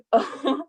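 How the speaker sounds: short teary laugh up high; tearful laugh. Real, not acted. Trustee laughing through tears mid-tribute. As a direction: let a wet laugh escape.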